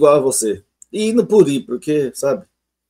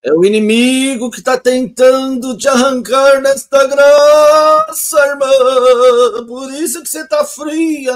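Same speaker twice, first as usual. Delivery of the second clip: mock preacher; 5 semitones higher than usual. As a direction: ham it up like a manipulative preacher warning the sister. Mocking a manipulative preacher.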